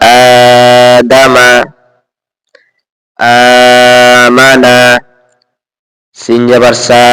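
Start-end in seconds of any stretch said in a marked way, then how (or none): 0.1-4.8 drawn-out Arabic vowels; slow demonstrated reading. Shown as a demonstration. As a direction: stretch each vowel slowly like a teacher. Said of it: "হরকত, জবর আছে".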